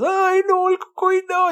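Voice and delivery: put-on high frightened child voice; scared child. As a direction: cry out like a frightened kid.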